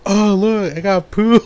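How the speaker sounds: high-pitched playful taunt; mock prank reveal. Acting brother's prank on sister.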